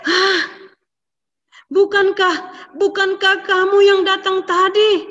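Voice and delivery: high, loud startled gasp of a question. A narrator's reading. Father realises he was tricked, shocked and high. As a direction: jolt up in shock. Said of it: "Papanya kaget".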